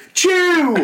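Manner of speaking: loud-pitched, high mock "achoo"; fake sneeze. Kid suddenly faking a sneeze in class, exaggerated achoo. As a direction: burst out a badly faked sneeze. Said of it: "That's what's called a fake sneeze".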